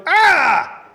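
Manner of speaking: sudden high loud yell; Goliath's shout. Acted. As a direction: let out a startled bellow. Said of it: "you hear the Goliath go".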